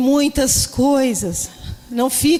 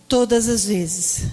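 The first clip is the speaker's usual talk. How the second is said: reverent reading; 3 semitones lower, slower than usual. Retold or imitated. Reads meaning found reverently.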